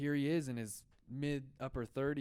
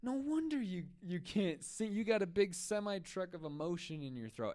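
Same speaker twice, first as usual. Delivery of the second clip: high, amazed mentor outburst; mock-astonished coach, pitched way up; the mentor marvels at Peter, high and amazed; exclaiming mentor; 9 semitones higher than usual. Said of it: "He's like".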